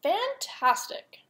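slow, high, drawn-out praise; flattering praise. Acted. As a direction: stretch it out to flatter him. Elizabeth flatters the dragon.

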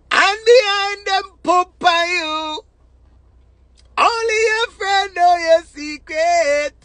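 slow, high, drawn-out preaching cadence. Acted. Preaching to the people about false friends, slow and high. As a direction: preach it slowly to the crowd, voice lifted high.

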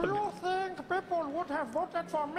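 very high parody voice; mock high voice. Shown as a demonstration. Mandela showing how silly Obama sounds, very high voice. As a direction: ask it far above your natural pitch. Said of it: "if I spoke like this".

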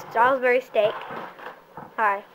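usual speaking voice

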